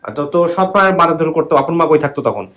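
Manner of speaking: loud, high questioning; to someone hard of hearing. Real, not acted. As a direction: call the question out clearly. আনো কম শোনেন.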